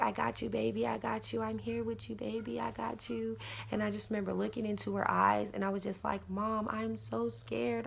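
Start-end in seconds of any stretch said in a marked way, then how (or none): 0.0-3.4 soft, higher comforting murmur; reassuring mother. Retold or imitated. Mom holds her frightened daughter, soft reassurance. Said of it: "she was, like, holding me". 6.3-7.9 small, high frightened voice, drawn out; retold fear. She looks into mom's eyes, soft retold fear. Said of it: "overwhelming feeling of fear".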